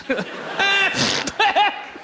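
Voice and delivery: high laugh. Real, not acted. Laughs at own joke.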